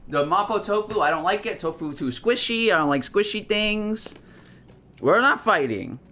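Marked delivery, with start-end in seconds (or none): 0.1-4.1 high-pitched exasperated rant; sounding angry. Real, not acted. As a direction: lament all the wasted talk, pitched high. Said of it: "Y'all sure sound angry".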